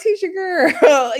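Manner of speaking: put-on high, slow, exasperated "Tisha, girl"; high exasperated cry. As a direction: cry out her name in disbelief. Calling out a friend.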